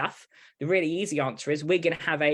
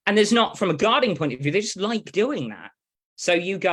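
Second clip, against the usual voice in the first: ordinary talk, nothing marked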